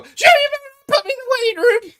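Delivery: extremely high, loud squeal of a voice; high-pitched silly voice. Shown as a demonstration. Host showing off another voice for the microphone test, high and loud. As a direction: squeak it out at full volume. Showing a voice he could use instead.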